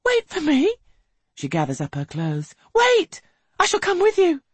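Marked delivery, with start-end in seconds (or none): 0.0-0.7 high, loud frightened plea; fearful. A narrator's reading. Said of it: "She rises now in true fear". 2.7-4.4 high, loud frightened plea; fearful. A narrator's reading. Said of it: "She rises now in true fear".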